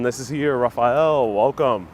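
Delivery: ordinary delivery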